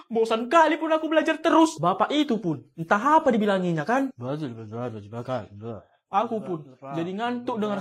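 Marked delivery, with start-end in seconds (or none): none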